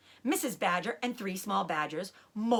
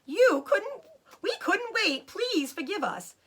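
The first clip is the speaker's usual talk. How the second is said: Missus Fox; 11 semitones higher, slower than usual. Acted. Missus Fox voiced high.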